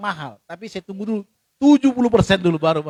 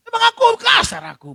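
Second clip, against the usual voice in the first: high, loud shouted protest; indignant shout; 14 semitones higher, 6 dB louder than usual. Retold or imitated. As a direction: shout it back, offended. Defensive man denies being rude.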